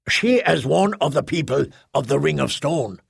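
ordinary delivery

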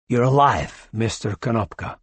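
usual speaking voice

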